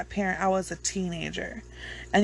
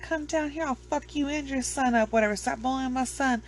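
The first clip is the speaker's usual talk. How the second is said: mock angry mother; 6 semitones higher than usual. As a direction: lightly act out a mom confronting a dad. Imitating angry mother confronting bully's dad.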